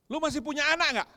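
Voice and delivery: put-on loud, sharp reproach from a hurt teenage daughter; angry daughter shouting. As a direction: throw the question at dad, hurt and defiant. Daughter confronts her father. (anak perempuan membentak ayahnya)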